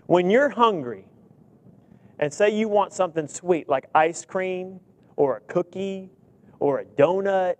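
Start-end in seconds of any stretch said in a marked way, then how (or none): none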